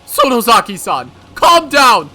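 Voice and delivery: high, loud, fast with a nervous laugh; nervous doctor. Acted. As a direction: bluff innocence in a panic. Nervous doctor gaslights Mion.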